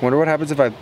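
ordinary talk

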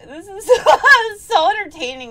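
high, helpless laughter between words; laughing hard. Real, not acted. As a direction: barely get the words out for laughing. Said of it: "shouldn't be laughing so hard".

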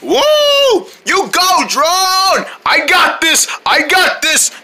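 high, whooping battle cries; fired-up cheering. Acted. Fighter charges the big guy, excited whooping. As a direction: whoop and holler at high pitch.

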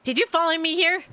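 a hint of a defensive schoolgirl catching stalkers; lightly voiced schoolgirl. Player voices Horikoshi.

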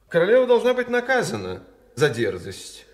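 loud, high indignant declaration; indignant demand. Acted. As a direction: insist on punishing her. King demands punishment.

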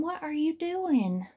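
high-pitched indignant storybook outcry; exclaimed. A narrator's reading. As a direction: exclaim it high, demanding an answer. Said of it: "Badge exclaimed".

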